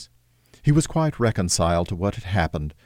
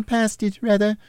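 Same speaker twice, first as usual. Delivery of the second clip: put-on Peter's boyish voice; 13 semitones higher than usual. Peter answers his mother, lightly voiced boy.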